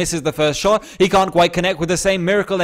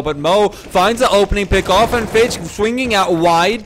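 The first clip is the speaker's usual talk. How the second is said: higher, louder burst of commentary; excited play-by-play; 6 semitones higher, 5 dB louder than usual. Real, not acted. Caster calls Mo's opening pick, excited and loud. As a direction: jump up in pitch and volume on the kill. Opening kill in live cast.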